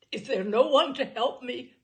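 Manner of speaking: despairing cry. A narrator's reading. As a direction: call out for help, all strength gone. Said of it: "in a tone of despair".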